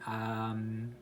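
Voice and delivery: normal talking voice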